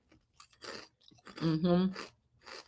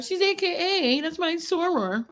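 high mocking voice; 11 semitones higher than usual. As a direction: squeak it out like a proud soror. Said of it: "And she's like".

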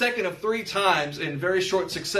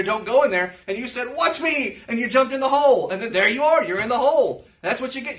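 higher, louder preacher's build; raised-voice sermon illustration; fired-up preaching; 4 semitones higher, 3 dB louder than usual. Acted. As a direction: drive the parable home from the pulpit. Preaching hole and ladder parable.

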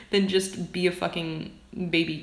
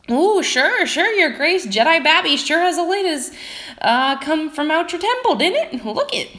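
put-on high, loud old hunchback voice; weird old man; 10 semitones higher, 7 dB louder than usual. Hunchback convincing the sergeant about the baby, weird old man voice. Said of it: "going back to my weird old man".

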